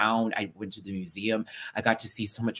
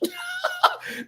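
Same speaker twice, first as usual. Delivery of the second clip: very high squeal of laughter; high shrieking laugh. Real, not acted. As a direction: let a high giggle burst out. Laughs after beach plea.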